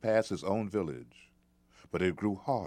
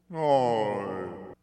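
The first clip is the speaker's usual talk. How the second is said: giant voice; 2 semitones higher, 4 dB louder than usual. Acted. Giant speaks.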